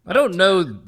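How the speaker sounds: flustered outburst. Acted. Player flustered in character.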